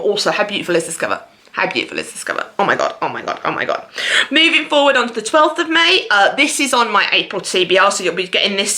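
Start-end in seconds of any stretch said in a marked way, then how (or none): 1.5-3.9 hushed awe. Real, not acted. Gushing over a book cover.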